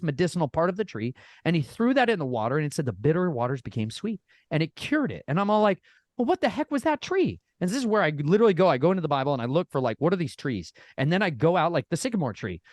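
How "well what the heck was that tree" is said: high-pitched mock astonishment; acted bafflement. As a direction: play up the puzzled amazement, pitched way up. Acts out own amazed reaction.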